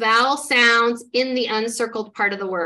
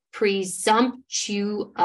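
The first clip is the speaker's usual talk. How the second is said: slow blended word; much slower than usual. Shown as a demonstration. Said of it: "let's try this".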